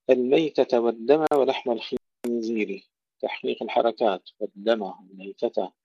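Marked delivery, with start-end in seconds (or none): none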